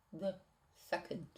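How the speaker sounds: usual speaking voice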